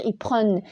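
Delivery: PRENNENT mispronounced as "preune"; wrong vowel. Shown as a demonstration. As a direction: say PRENNENT with "eu" in place of the open è. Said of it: "c'est faux".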